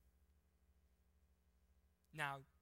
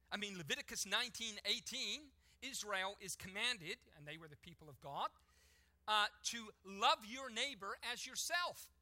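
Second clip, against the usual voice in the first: higher and louder, driving sermon voice; preaching at full force; 7 semitones higher, 8 dB louder than usual. Acted. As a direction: preach it out to the whole room.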